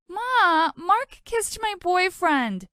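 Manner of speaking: high whiny cry to Mama, drawn out slow; whiny tattling. Acted. Sister whining to Mama about her brother. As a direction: tattle like a wronged little sister.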